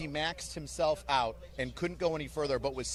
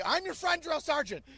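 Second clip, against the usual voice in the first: much higher, chirpy recruit reply; eager recruit; 12 semitones higher than usual. Retold or imitated. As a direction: squeak it out like an eager private. Coached reply for private.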